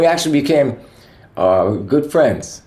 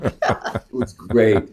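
laughing; much slower than usual. Real, not acted. Laughing at the memory.

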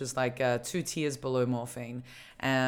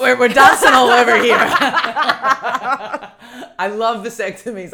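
loud, high, laughing all through; 8 semitones higher, 15 dB louder than usual. Real, not acted. Host cracking up at their own tangent, laughing. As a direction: talk through a big burst of laughter.

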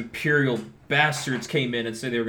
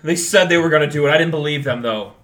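louder, for the whole room; 4 semitones higher, 11 dB louder than usual. Acted. Stoltz telling the bar crowd, a loud declaration. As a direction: announce it to the bar. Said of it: "Stoltz is gonna say out loud".

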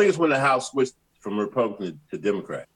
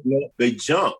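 usual speaking voice

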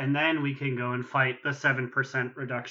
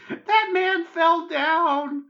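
put-on high, loud, drawn-out kid's cry; child soldier; 6 dB louder, much slower than usual. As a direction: play a wide-eyed kid shouting over battle. Voicing an imagined child soldier.

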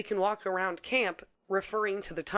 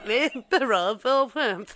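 slow line through laughter; laughing imitation; 3 semitones higher, 5 dB louder, slower than usual. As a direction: repeat it while cracking up. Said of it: "Fucking David Attenborough bullshit".

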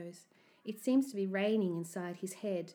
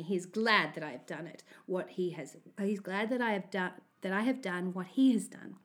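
plain reading voice

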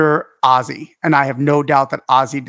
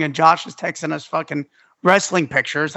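normal talking voice

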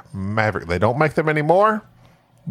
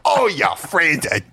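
put-on Cockney accent; 4 semitones higher, slower than usual. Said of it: "that real bad Cockney accent".

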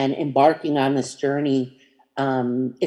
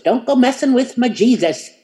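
imitating a thick Southern accent, higher and louder; 9 semitones higher, 6 dB louder than usual. Older mentor joking about theology, in a thick Southern accent. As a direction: mimic an old Southern lady in a thick Southern accent. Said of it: "she would say it with this thick Southern accent".

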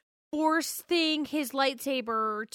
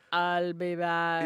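slow, low mumbled action-hero line; lightly voiced Arnold, mouth full of marbles; 6 semitones lower, slower than usual. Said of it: "talk with marbles in my mouth".

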